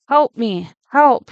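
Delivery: desperate cry for help. Acted. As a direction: beg the radio for rescue, voice rising. Stranded boy begging over radio.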